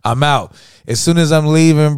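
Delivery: ordinary delivery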